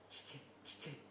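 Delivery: urgent secret whisper, almost no voice. Retold or imitated. Peter whispering to John to ask Jesus, a hushed whisper. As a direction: hiss it across the table so no one hears.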